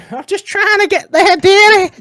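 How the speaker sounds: put-on high, loud old dear voice; old lady. Old lady on the bus telling the driver, high old-dear voice. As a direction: play a pensioner off to bingo. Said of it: "just trying to get to bingo".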